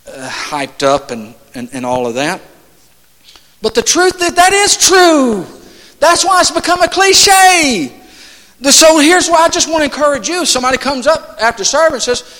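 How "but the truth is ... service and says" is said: high, insistent pulpit delivery; emphatic, high-pitched exhortation; fervent preaching. Real, not acted. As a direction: press the truth home to the congregation. Preaches at full force.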